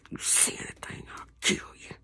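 hushed threatening whisper; menacing whisper. Retold or imitated. Abuser whispering a threat to the child. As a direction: hiss the threat low and quiet.

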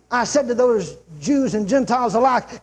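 usual speaking voice

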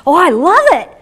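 loud, high burst of delight; enthusiastic boy. Retold or imitated. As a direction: gush like an excited kid. Retelling boy's keen answer.

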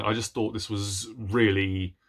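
ordinary talk

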